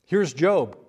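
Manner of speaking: ordinary talk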